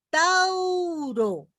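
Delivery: "Tauro" agudo y alargado, con la U resaltada; ejemplo marcado. Shown as a demonstration. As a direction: di "Tauro" alto, estirando la U. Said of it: "Fíjense". ("Tauro" agudo y lento)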